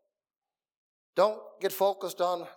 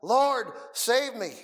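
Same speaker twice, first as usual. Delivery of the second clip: slow, loud and higher cry for help; desperate cry; 7 semitones higher, 4 dB louder, much slower than usual. Retold or imitated. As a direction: call out slowly like a man about to drown. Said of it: "he just cried".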